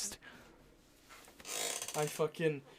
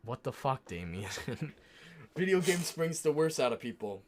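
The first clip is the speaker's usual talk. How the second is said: normal talking voice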